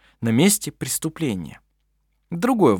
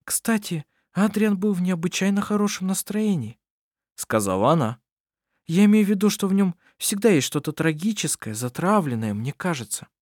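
lightly raised, gentle woman's voice; young woman voice; 8 semitones higher than usual. Acted. Anna-Maria speaks of Adrian.

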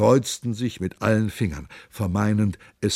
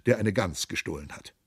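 ordinary delivery, nothing marked

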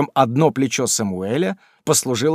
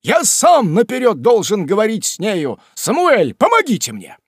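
urgent call; 10 semitones higher, 6 dB louder than usual. Acted. Pickwick urgently calls Sam.

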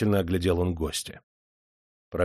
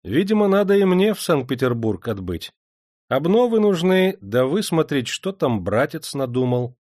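higher-pitched, pleased voice; lightly amused, raised pitch; smiling; 5 semitones higher, 3 dB louder than usual. A narrator's reading. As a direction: say it through a smile. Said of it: "наследник улыбнулся и сказал".

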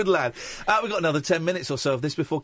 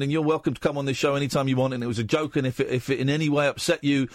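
usual speaking voice

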